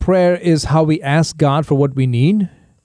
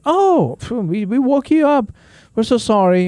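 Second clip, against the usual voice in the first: apologetic family; 9 semitones higher than usual. Retold or imitated. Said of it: "Guys, one thirty, two o'clock in the morning".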